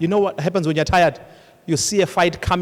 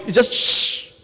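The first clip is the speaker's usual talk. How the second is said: sizzle noise; 4 semitones higher, 4 dB louder, slower than usual. Retold or imitated. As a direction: mimic a quick sizzle. Imitates water hitting oil.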